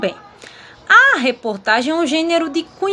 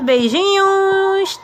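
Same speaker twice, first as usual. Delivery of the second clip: beijinhos stretched slowly and pitched higher; a slow, higher fond farewell; drawn-out goodbye; 6 semitones higher, 3 dB louder, much slower than usual. Real, not acted. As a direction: sing out beijinhos long and high. Goodbye to the class.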